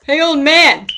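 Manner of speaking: loud call to someone across the street. Real, not acted. Calling out to a stranger.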